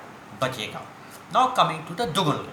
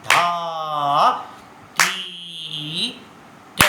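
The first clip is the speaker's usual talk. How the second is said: each bol धा, ती, ट spoken slowly with long gaps; slow, spaced-out syllables; slow tabla bols; much slower than usual. Shown as a demonstration. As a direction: recite the bols at a slow vilambit pace. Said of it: "अभी हमने बोला था विलंबित लय में".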